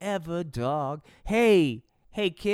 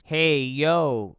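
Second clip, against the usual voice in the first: loud call; 3 semitones lower, 7 dB louder than usual. Acted. Kim calling out in class.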